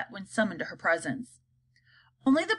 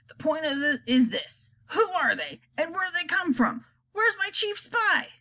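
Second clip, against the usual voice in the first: high president voice; president demanding; 7 semitones higher than usual. Acted. President questions advisors in a high, urgent voice. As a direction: demand answers from the room. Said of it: "said the president".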